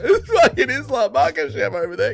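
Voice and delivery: laughing. Real, not acted. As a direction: laugh while you say it. Laughing at the Aussie plight.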